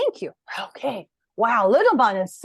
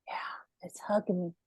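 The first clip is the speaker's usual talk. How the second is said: whisper; 3 semitones lower, 9 dB quieter than usual. Real, not acted. Soft whisper after teaching.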